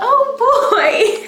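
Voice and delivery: high, laughing squeaky mouse; Mickey Mouse voice. Pretend. Echoes Mickey Mouse for toddler.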